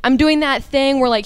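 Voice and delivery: ordinary delivery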